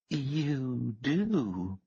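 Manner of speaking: put-on coy Southern accent, simpering; Southern drawl. A narrator's reading. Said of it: "she said in a thick Southern drawl".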